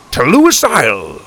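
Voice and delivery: loud, high battle cry; rallying call. A narrator's reading. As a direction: proclaim the destination boldly. Grandpa calls out departure.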